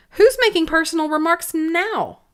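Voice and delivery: high, gloating gotcha question; triumphant. Acted. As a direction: crow it in triumph, lightly. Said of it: "asked triumphantly".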